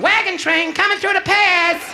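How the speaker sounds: very high, slow put-on call; wife's call. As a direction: sing it out high and slow like an announcement. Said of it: "she was going".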